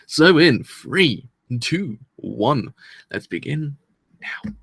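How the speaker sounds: slow, drawn-out countdown; dramatic countdown. Real, not acted. Vlogger counts viewers into the episode, slow and drawn out. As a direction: stretch out "So in three" like a launch.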